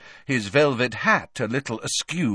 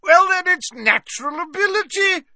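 high, loud exclamation; shouting Slughorn; 6 dB louder than usual. A narrator's reading. Slughorn shouting back at Snape, high and loud. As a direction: burst out over him, high. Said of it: "shouted Slughorn".

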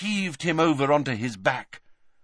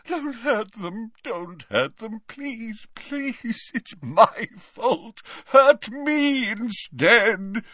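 Dumbledore moaning; 8 semitones higher, slower than usual. A narrator's reading. Dumbledore tortured by visions, moaning pleas. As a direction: moan and beg in torment. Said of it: "as he moaned".

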